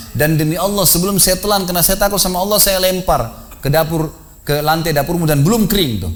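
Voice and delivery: earnest oath. Retold or imitated. Husband swears honest confession.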